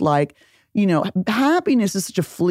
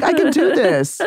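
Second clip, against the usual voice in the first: a higher, hammed-up pep talk to herself; mock determined; 8 semitones higher than usual. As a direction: psych yourself up, half joking. Mock self-convincing about dating women.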